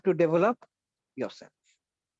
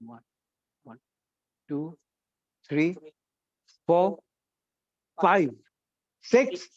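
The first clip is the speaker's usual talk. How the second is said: counting louder and louder; 4 semitones lower, slower than usual. Shown as a demonstration. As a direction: build the volume with every count. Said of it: "we will count louder".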